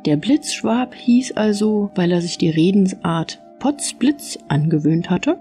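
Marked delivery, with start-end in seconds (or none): none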